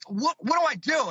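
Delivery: high, helpless what-do-I-do cry; retold panic. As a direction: beg for an answer, pitched well up. Panicked run from Sasquatch.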